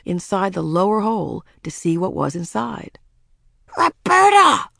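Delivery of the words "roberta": high, loud shout of the name; sudden high-pitched yell; alarmed cry. A narrator's reading. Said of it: "cried Ramona".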